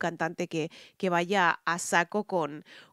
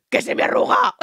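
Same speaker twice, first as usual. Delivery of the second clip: very high, loud shriek; woman at a traffic light crying out in panic; panicked cry; 9 dB louder than usual. Retold or imitated. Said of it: "El decir en un semáforo".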